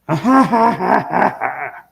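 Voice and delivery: high, loud burst of laughter. Real, not acted. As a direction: laugh out loud in a long peal. Laughs loudly at own remark.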